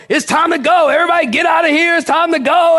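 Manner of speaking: high, loud mock yell of an angry nurse; retold yell. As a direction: bark it like a fed-up nurse ordering everyone out. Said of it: "she yelling at people".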